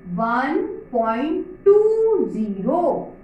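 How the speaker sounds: slow, each number set apart; slow demo reading. Shown as a demonstration. Teacher showing children how to read a decimal, slowly. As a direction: read one point टू zero slowly, word by word. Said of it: "इसे कैसे पढ़ोगे?".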